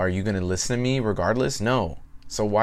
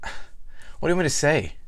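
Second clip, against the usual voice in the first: higher-pitched exasperated retort; 8 semitones higher, faster than usual. Retold or imitated. Trainer answering the same old client questions, exasperated. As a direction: throw it back in exasperation, pitched up.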